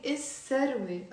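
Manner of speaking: الثروة as is-serwe, S doubled; silent lam. Shown as a demonstration. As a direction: hold the S in الثروة with no L. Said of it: "when I'm saying".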